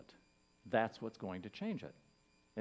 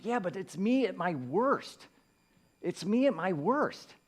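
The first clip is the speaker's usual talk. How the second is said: raised, pleading self-talk; mock inner protest; 8 semitones higher, 6 dB louder than usual. Telling the Spirit his own defence, mock pleading. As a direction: blurt it out like a guilty kid. Inner voice quoted, far, loud.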